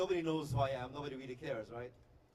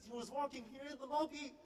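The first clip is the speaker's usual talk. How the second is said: put-on very high, softer girlish squeak; freaking-out girl fan; 9 semitones higher, 5 dB quieter than usual. British girl telling her friend, excited high voice. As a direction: answer in a high, starstruck squeal. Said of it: "they both start freaking out".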